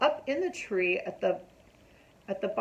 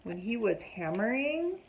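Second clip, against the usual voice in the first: ordinary delivery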